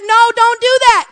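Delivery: a stern hint of the Spirit saying no; voice of God. Preacher voicing the Spirit of God, a firm no. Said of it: "the Spirit of God say".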